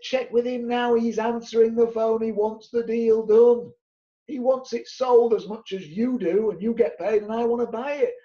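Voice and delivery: insistent pushing. Retold or imitated. Pushing agents to call landlord.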